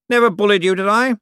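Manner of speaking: condescending lord to servant; Cosmo's voice. Acted. To his butler, Cosmo's lofty patronizing voice.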